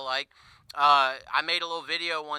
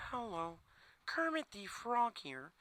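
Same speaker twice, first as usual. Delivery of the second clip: softer, slow, toned-down frog voice; realistic Kermit; 4 semitones higher, 12 dB quieter, slower than usual. Retold or imitated. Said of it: "be Kermit buying groceries".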